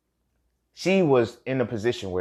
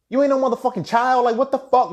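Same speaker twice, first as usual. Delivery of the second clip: high, loud retold telling-off; exasperated scolding; 7 dB louder than usual. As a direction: scold with voice pitched way up. Acted scolding of the friend.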